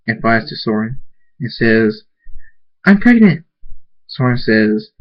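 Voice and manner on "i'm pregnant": high and loud with joy; thrilled shout; excited announcement. Acted. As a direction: burst out the happy news. Skugga tells Soren the news.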